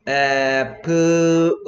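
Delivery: 애플 drawn out slowly, sound by sound; slow stretched demo. Shown as a demonstration. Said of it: "A가 뭐 됐어요".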